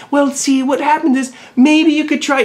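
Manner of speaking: high, sugary mock gentleness; mock soft coddling. Vlogger mocking the gentle advice she refuses to give, sugary. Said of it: "I'm not gonna soften and be like".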